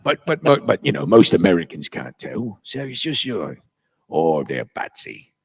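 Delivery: put-on Cockney, sinking low; low fake Cockney character; bad Cockney. Shown as a demonstration. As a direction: slide down into fake London voice.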